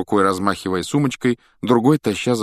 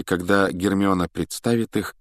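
ordinary delivery

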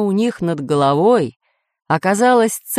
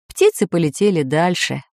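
ordinary delivery

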